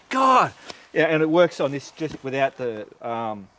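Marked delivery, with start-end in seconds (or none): 0.1-0.5 higher, louder burst of frustration; exasperated exclamation. Retold or imitated. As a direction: blurt it out fed up. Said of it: "And I'm thinking".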